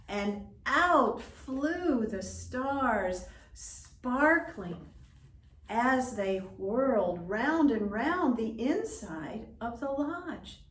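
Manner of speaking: wondrous telling. A narrator's reading. Narrator tells the children of the stars, in wonder. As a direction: marvel as the stars fly out.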